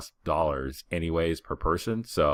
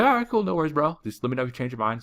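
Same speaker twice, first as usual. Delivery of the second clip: much higher, breezy, no-worries voice; easygoing seller; 11 semitones higher, 4 dB louder, faster than usual. Retold or imitated. Street seller shrugging off the refusal, breezy and friendly.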